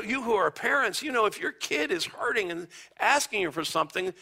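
ordinary talk

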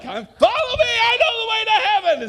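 needless yelling. Retold or imitated. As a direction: yell like a clown in a dunk tank. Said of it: "just yelling at everyone needlessly".

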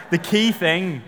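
a hint of the skydiving instructor pressing "key"; emphatic instructor. Instructor briefing a nervous first-timer, leans on "key". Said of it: "but he said".

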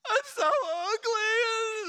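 high, loud mock-sobbing wail at the mirror; mock crying woman. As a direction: ham up a woman crying over her looks. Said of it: "she looks at herself and is like".

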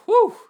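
loud high whew of relief; relieved whew. Real, not acted. Preacher finishing a long round, loud high whew. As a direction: blow out a big relieved whew. Sighs after a long section.

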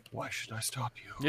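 low, slow, soft villain line; quietly menacing, unhurried. Retold or imitated. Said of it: "where Apocalypse went".

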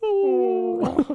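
high, drawn-out "ooh"; imitated dog whine. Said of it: "And we just hear".

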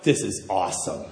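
very low aside to himself; secret self-congratulation. Retold or imitated. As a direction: drop right down, a sly private thought. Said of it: "I'm saying to myself".

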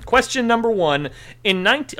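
quiz host. Acted. Quizmaster announcing question.

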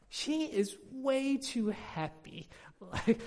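high, slow, beaming delight; delighted gushing. Real, not acted. Father gushing to the congregation about his daughter, delighted. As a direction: gush over how happy she is.

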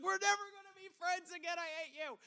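hammed-up boyish fury in a small high voice; mock anger. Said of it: "all the anger that I felt".

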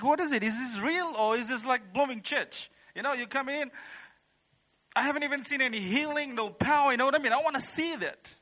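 high-pitched, exasperated questioning; doubting complaint. Retold or imitated. Churchgoer complaining to Darren about church, exasperated.

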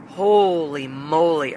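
slow, drawn-out Holy moly in stunned disbelief; shocked exclamation. Retold or imitated. Hearing shocking news about the teacher, slow stunned exclamation. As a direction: stretch it out, floored by the news. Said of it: "I was shocked".

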